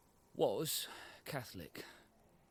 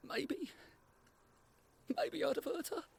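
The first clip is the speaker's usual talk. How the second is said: high, unsettled voice. Acted. Wavering answers about hurting wife.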